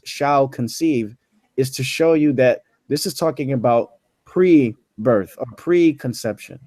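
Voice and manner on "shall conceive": "shall conceive" said slowly and deliberately; slow, weighted "shall conceive"; stress on shall conceive. Shown as a demonstration. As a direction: drag out each word of "shall conceive". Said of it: "I'm emphasizing those words".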